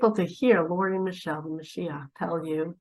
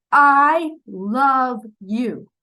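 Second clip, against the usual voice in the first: very slow, louder, heartfelt; with meaning; 4 semitones higher, 6 dB louder, much slower than usual. Shown as a demonstration. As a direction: say it slowly and mean it.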